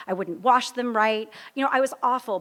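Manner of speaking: normal talking voice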